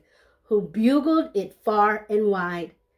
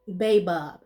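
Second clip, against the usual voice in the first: plain reading voice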